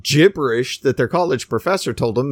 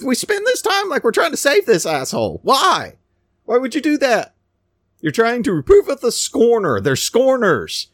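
fiery preaching; 9 semitones higher than usual. Acted. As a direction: preach it out hard at the congregation.

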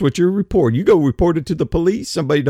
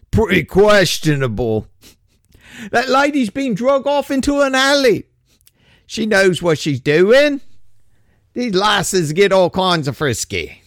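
bad British accent; 3 semitones higher than usual. Cop calling to partner Nigel, a hammed-up British accent. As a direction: play a bumbling English bobby, badly on purpose. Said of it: "I apologize for the shitty accent".

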